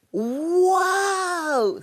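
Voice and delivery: high delighted shout of wow; excited wow. A narrator's reading. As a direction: gasp out a thrilled wow. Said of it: "said Douglas".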